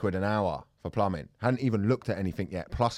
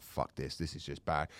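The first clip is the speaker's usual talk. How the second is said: much softer, lower, under the breath; muttered aside; 9 semitones lower, 11 dB quieter than usual. Retold or imitated. Son to his dad behind the plumber's back, hushed aside. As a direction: keep it low so the plumber can't hear.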